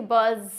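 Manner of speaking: "बज़" with the final z held long; doubled consonant. Shown as a demonstration. Teacher showing how double letters should not be read, doubled consonant. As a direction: drag out the z at the end of "बज़". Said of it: "ऐसा नहीं है कि".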